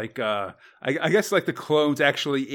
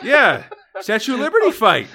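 laughing; 8 semitones higher, 5 dB louder than usual. Real, not acted. Laughing at the fight.

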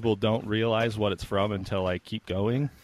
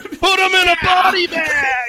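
shouted quote; 8 dB louder than usual. Retold or imitated.

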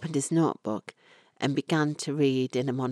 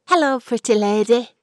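put-on high, louder, delighted boy's greeting; child's voice; 7 semitones higher, 6 dB louder than usual. A narrator's reading. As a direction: beam at her like a happy little boy. Lad greets Florrie, beaming.

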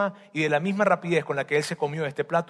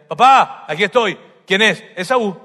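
loud eager call to father; 3 semitones higher, 8 dB louder than usual. Acted. Esau arrives calling his father, loud call. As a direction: burst in announcing yourself.

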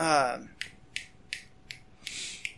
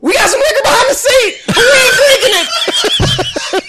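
laughing mock confession; 13 semitones higher, 3 dB louder than usual. Friend confessing to cop, laughing.

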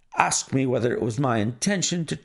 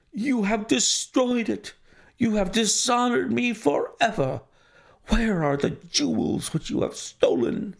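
high, anguished accusation; beside himself with rage; 8 semitones higher than usual. A narrator's reading. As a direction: accuse in high-pitched outrage, not shouting. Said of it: "beside myself with rage".

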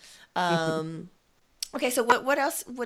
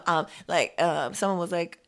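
usual speaking voice

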